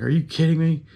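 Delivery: high, pushed disbelief; incredulous. Retold or imitated. To the desk clerk, high incredulous disbelief. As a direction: throw it back at him, can't believe it. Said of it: "And I was like".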